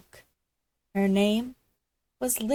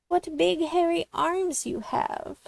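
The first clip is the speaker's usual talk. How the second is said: careful, wary question; carefully; 8 semitones higher than usual. A narrator's reading. Little Red carefully questions the odd Granny. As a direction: ask it carefully, unsure. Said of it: "Little Red said carefully".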